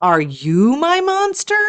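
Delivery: ordinary delivery, nothing marked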